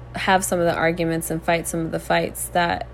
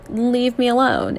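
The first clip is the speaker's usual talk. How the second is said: eye-roll dismissal; 5 semitones higher, 4 dB louder than usual. Retold or imitated. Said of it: "roll my eyes at him, and be like".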